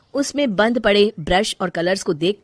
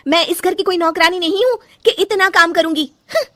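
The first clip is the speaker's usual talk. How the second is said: much higher, loud, angry with a sob; tearful anger; 10 semitones higher, 5 dB louder than usual. Acted. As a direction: shout back near tears. Quarrel with mother-in-law. (रोते हुए गुस्सा)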